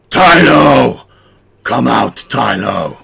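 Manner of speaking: hissing reptile growl, shouted and amplified; loud reptilian voice. Acted. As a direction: bellow like an amplified lizard thug. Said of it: "a loud reptilian voice".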